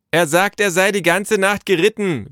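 high, loud relay; repeating by calling. A narrator's reading. Naru relaying the messenger's words to father, calling out. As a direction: holler the report indoors. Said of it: "wiederholte Naru".

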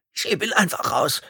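high, strained rasp; choked, effortful call; rasping wheeze. A narrator's reading. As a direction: wheeze the order out. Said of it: "röchelte Carter".

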